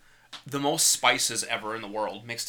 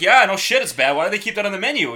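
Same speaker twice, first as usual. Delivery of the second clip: high, loud incredulous retort; retold exasperation; 7 semitones higher, 7 dB louder than usual. Man retelling his retort to a coworker, loud exasperation. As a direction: fire back in mock exasperation.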